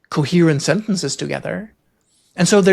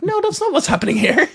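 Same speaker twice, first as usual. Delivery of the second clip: much higher mock-exasperated voice; exasperated self-quote; 12 semitones higher than usual. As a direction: squeak it out, exaggerating your own protest. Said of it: "It's like".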